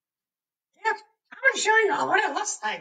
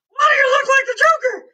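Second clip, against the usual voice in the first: high, loud mock-shocked question; incredulous retort; 8 semitones higher, 5 dB louder, faster than usual. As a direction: blurt it out like a startled onlooker. Retold startled reaction to lipstick.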